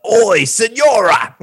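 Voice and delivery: high, loud British-style yell; exaggerated British shout; mock British accent. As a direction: holler it in a put-on British accent. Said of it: "was this person British?".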